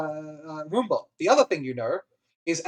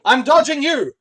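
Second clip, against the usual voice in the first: raised, loud mock battle cry; training call-out; 8 semitones higher, 7 dB louder than usual. Droid pointing at a dummy, loud call-out.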